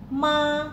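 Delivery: ma high and level; first tone ma. Shown as a demonstration. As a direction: hold ma high and flat. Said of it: "Nada pertama dibaca tinggi".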